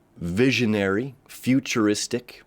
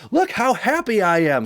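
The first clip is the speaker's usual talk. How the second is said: high, showy mock happiness; mock gloating; 12 semitones higher, 4 dB louder than usual. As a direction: rub it in with exaggerated glee. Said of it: "like we're rubbing it in their face".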